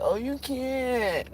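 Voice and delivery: slow, soft, mocking "Oh, you can't."; drawn-out sarcastic retort. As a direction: let "Oh, you can't." drag out with disdain. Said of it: "I might not have said it like that".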